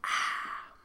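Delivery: breathy satisfied aah after drinking; a relieved exhale after a drink; refreshed sigh after a sip. Real, not acted. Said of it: "now you know that I've had my sip of drink".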